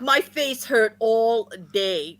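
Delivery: slow, drawn-out "my face hurt all day"; dragging each word of "my face hurt all day"; emphatic. Real, not acted. Repeats it slowly for emphasis.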